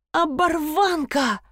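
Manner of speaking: high, drawn-out squeal; shriek. A narrator's reading. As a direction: shriek it high, stretching the word. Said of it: "взвизгнула Нина". (протяжный высокий взвизг)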